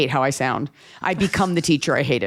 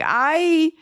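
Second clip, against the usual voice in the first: high, swooning cry; a hint of sensual moaning; mock ecstatic eater; 12 semitones higher than usual. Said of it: "he, like, eats around her".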